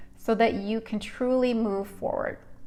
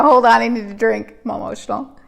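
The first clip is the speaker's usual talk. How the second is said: ordinary talk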